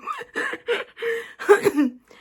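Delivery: high, loud burst of laughter; real laugh. Real, not acted. Laughs after mentioning Liam.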